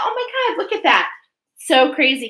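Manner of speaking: high, loud burst of surprise; surprised excitement. Real, not acted. Reader thrilled by a spread she forgot, loud surprise. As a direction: gasp at the cards, thrilled.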